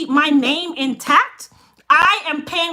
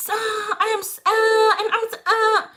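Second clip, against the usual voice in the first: a hint of a flustered pleading stammer, higher; mock stammering; 7 semitones higher than usual. Mocking timid sellers who stammer, a hint of flustered stammer. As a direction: stutter like a nervous pushover, mildly teary. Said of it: "me not have time for all of that".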